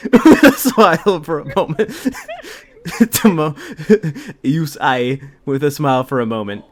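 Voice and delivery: ordinary delivery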